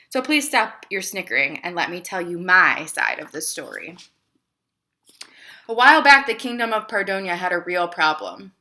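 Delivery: lively read-aloud to a class; story narration. A narrator's reading. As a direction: read it like a picture book for kids. Teacher reads picture book aloud.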